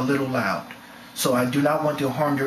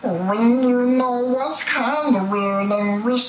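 higher, slow cartoon voice; cartoon voice impression; 7 semitones higher, slower than usual. Shown as a demonstration. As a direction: drawl it out like a cartoon character. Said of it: "voice impressions".

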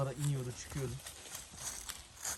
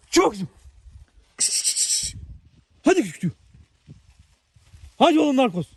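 talking to a dog; 8 semitones higher, 10 dB louder than usual. Real, not acted. Coaxing and praising the dogs.

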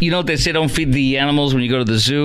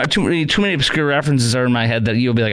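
usual speaking voice

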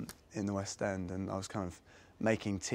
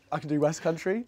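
light West Country English lilt, raised pitch; West Country accent; 4 semitones higher, 5 dB louder than usual. Shown as a demonstration. Actor showing off accents on request, West Country accent. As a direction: have a go at West Country, ending on a rise. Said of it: "I can do West Country like that".

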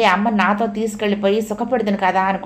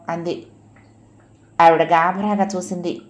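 ordinary delivery

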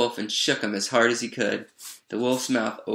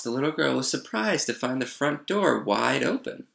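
usual speaking voice

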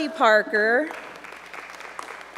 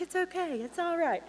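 higher and softer, a mild soothing voice; imitating a calm friend; 6 semitones higher, 7 dB quieter than usual. Teacher imitating McKinley calming the class, soft and soothing. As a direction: lightly play a gentle girl calming things down. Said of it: "She's like".

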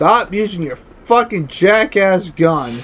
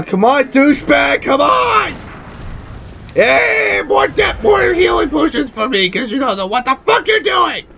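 high-pitched, heated gamer outburst; exasperated taunting; 4 semitones higher than usual. Real, not acted. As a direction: climb into an angry, sarcastic jeer at the opponent. Raging at the game opponent.

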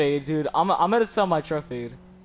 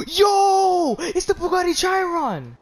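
excited shout; 9 semitones higher, slower than usual. Real, not acted. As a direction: yell it out, thrilled to spot the car. Spots the car, yells in excitement.

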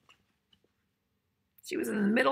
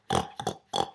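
short, rough pig snorting; pig snorts; 4 dB quieter than usual. Shown as a demonstration. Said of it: "if you're really good at making pig sounds".

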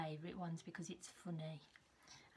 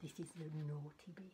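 softer, lower, a secret to share; conspiratorial aside; 3 semitones lower, 7 dB quieter than usual. Real, not acted. Telling children the rude part is coming, softly. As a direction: let them in on the naughty secret. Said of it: "aside about rude bit".

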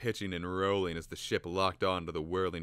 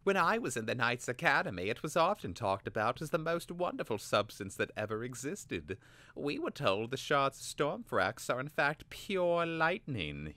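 oily, treacherous; 8 semitones higher than usual. A narrator's reading. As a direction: coax the secret out smoothly. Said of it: "his voice oily, treacherous".

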